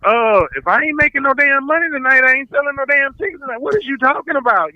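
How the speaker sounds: mock indignant comeback; indignant retort. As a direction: throw the question back at him. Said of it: "I'm like".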